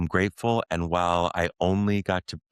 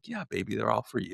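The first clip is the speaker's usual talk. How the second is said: put-on softer, gentle parent to small child; tender reassurance; 3 semitones higher, 5 dB quieter, faster than usual. Father retells telling young son the fireworks are his, tender and soft. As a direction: reassure your little one softly. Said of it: "I'm like".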